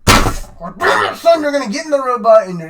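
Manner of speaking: loud, high burst on the first word, then easing; lightly acted commanding father; Shinji's dad. Ordering Shinji into the robot, mock stern dad. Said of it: "this dad's like".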